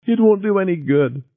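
crying. A narrator's reading. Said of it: "She was crying over the intercom".

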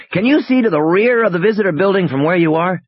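high, urgent radio question; pressing, animated instructions. Acted.

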